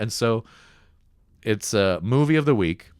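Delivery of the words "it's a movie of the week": usual speaking voice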